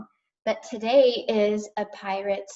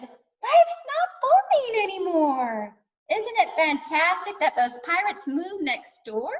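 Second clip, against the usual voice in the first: excited shout; 10 semitones higher than usual. A narrator's reading. Tilda shouting about the pirates next door, excited. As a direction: call out the great news, pitched way up. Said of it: "Tilda shouted".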